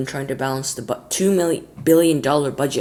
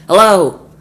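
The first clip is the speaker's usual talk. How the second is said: loud phone shout; 6 semitones higher, 13 dB louder than usual. Acted.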